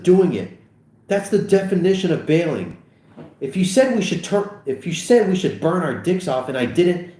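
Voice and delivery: high, insistent quarrel; worked-up bickering; heated arguing. Acted. Acted quarrel between two friends.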